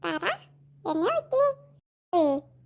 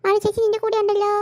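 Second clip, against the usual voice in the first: ordinary delivery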